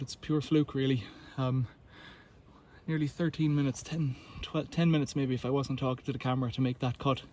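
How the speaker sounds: ordinary delivery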